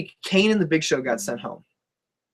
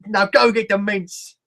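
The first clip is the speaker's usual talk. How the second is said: slow, higher-pitched fake English accent; mock English accent; 4 semitones higher, slower than usual. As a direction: stretch it out in a silly English accent. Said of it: "Is your friend from England?".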